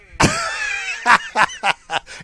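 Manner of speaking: loud, high burst of laughter; big laugh. Real, not acted. Host cracking up at a joke about youngers, big laugh.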